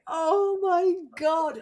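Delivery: high, loud, drawn-out retold dismay; mock mortified. Realising what was written on the prompt card, mock mortified. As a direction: wail it slowly in hammed-up embarrassment.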